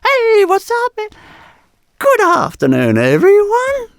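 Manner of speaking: very high, loud, over-the-top announcer welcome; hammed-up erratic event host greeting; erratic hyped host. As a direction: ham it up like a frantic showman. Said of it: "speak like this".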